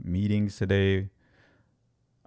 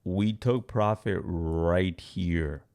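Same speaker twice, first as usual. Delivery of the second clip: ordinary talk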